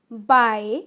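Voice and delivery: ordinary talk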